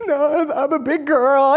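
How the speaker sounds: mock tough self; brave front. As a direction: brush it off bravely. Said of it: "I'm like".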